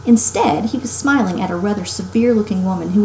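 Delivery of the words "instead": ordinary delivery, nothing marked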